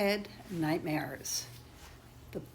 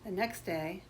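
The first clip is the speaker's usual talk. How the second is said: ordinary delivery, nothing marked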